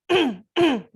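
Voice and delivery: short, forceful "ahem"; demonstrative throat-clearing. Shown as a demonstration. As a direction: clear the throat sharply to show the bad habit. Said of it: "Jangan kita sering".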